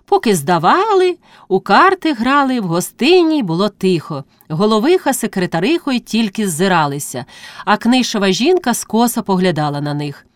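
usual speaking voice

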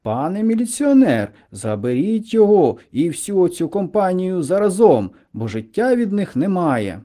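mildly hammed-up outraged citizen; indignant complaint. A narrator's reading. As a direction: demand they be taken away, loud and high. Indignant man appeals to policeman.

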